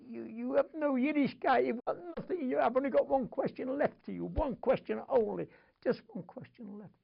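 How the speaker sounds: high, loud desperate plea, near tears; a father begging through tears; tearful pleading cry. Retold or imitated. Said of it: "He was crying".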